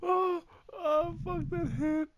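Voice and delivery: high pained yelp, slowed; pained cry. Real, not acted. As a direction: cry out as if just hit. Hit hard in game.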